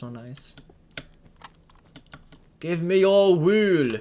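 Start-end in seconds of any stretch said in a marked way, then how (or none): 2.6-3.9 loud, high mock command with drawn-out vowels; playful demand. Player demanding wool from a sheep, playfully commanding. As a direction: call it out slowly like a silly villain.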